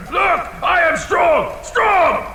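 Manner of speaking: high, loud bragging shout; boastful bellow. Acted. Dragon showing off his strength to the thief, loud boasting. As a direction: puff up and brag.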